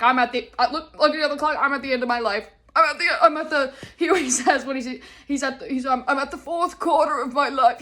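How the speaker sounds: loud, lightly voiced theatrical old dad; milk dad's end-of-life complaint; imitating dramatic dad. Dad claiming he's at the end of his life, hammed up. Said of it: "He's like".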